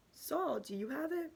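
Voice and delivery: lightly voiced, singsong Papa; Papa questioning. A narrator's reading. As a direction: ask the next child as Papa. Papa questions each child in turn.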